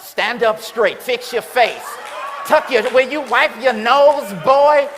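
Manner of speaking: loud, high scolding parent voice; mock parent scolding. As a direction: bark at the kids like a fed-up parent. Parent scolding family for photo.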